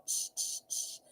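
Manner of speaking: breathy shushing to hush herself; shushing whisper. Retold or imitated. Shushing herself about the places she wanted to go, whispered. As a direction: whisper shh to silence her own hopes. Shushing her own wishful thoughts.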